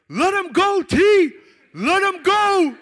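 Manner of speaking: high, very loud urgent yell; calling-off shout. Retold or imitated. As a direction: holler to call your friend off. Friend calls him off.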